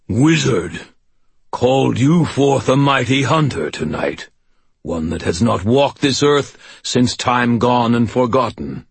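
imperious, booming ancient hunter; menacing fae king voice. Acted. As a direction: speak like a cold fae lord. Earl King speaks to wizard.

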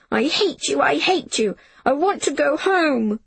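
put-on high, wailing child's protest; sobbing outburst. Jamie wailing at Miss Smith as she holds him. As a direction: howl it at her through tears. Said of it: "Jamie sobbed".

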